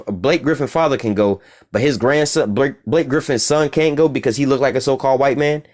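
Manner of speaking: usual speaking voice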